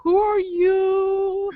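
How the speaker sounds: high, slow bewildered asking; lightly voiced confused strangers; mock baffled crowd; puzzled otakus. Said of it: "looking at me going".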